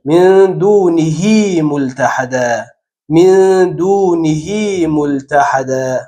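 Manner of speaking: «من دونه ملتحدا» very slowly, the ه in دونه drawn long as hee; stretched hi. Shown as a demonstration. Teacher chants the example with ishba, very slow. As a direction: lengthen the pronoun ha in دونه.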